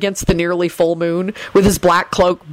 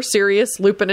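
usual speaking voice

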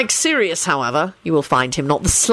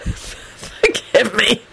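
slow, higher, recovering from laughing; struggling through laughter; 5 semitones higher, much slower than usual. A narrator's reading. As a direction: squeeze out an apology through mirth. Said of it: "laughing so hard he could not speak".

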